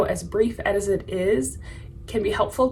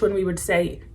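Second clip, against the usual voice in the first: usual speaking voice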